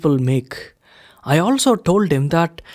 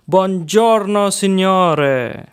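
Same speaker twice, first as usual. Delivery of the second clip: Italian waiter; 2 semitones higher, much slower than usual. A narrator's reading. Italian waiter greets them.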